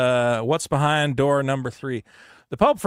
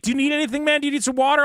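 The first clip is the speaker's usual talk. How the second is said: higher, lightly voiced helpful guard; a hint of a concerned guard; 9 semitones higher, faster than usual. Security guard offers water.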